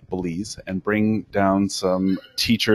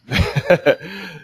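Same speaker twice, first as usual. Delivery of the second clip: short nervous laugh; 8 semitones higher than usual. Real, not acted. Laughs mid-sentence.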